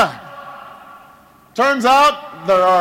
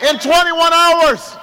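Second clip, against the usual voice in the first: higher, slow ringing oratory; impassioned rally shout; 4 semitones higher, slower than usual. Real, not acted. Candidate rousing Virginia before the polls open, shouting. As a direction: build to the climax, stretching the words over the crowd. Rally speech climax to crowd.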